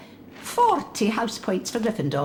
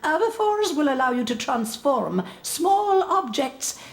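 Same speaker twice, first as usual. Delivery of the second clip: usual speaking voice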